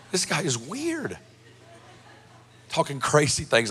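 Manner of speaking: muttered aside. Retold or imitated. Thinking to himself during the meeting.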